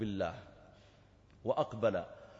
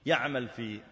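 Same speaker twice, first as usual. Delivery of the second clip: usual speaking voice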